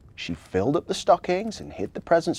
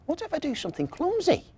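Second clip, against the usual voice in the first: high, quicker elf voice, anxious; worried little elf; 13 semitones higher, faster than usual. A narrator's reading. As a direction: fret like a nervous elf. Marzi worried.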